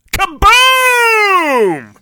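put-on kid-style shout of the ship blowing up; big kaboom. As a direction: belt it out like a blast. Explosion in story.

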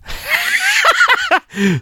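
a mock shouted evil laugh; villain cackle. Sinister laugh after secret.

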